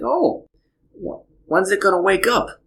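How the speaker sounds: put-on high, curious childlike voice; innocent little girl. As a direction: ask like a trusting four-year-old. Dad voices young daughter.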